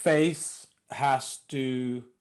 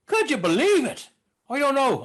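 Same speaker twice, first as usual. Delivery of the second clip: Walken attempting Irish, high and swooping; Christopher Walken Irish accent; 6 semitones higher than usual. Comedian demoing a film star's botched Irish accent, high Walken-gone-Irish. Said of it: "trying to get Walken's accent and then putting a terrible Irish accent on it".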